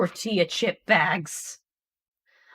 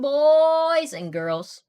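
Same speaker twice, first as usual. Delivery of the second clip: announcer voice; 7 semitones higher, 6 dB louder, much slower than usual. Real, not acted. End-of-stream announcement.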